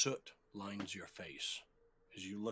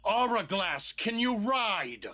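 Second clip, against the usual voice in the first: louder urgent repeat; 11 semitones higher, 12 dB louder than usual. Acted. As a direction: call again, more desperate.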